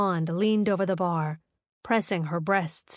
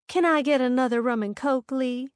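sweet, higher flirty ask; sugar-coated request; sugary; 5 semitones higher than usual. Acted. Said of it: "she asked in a sugary voice".

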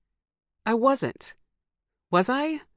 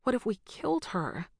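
croaked; 6 semitones higher, 5 dB quieter than usual. A narrator's reading. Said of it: "I croaked".

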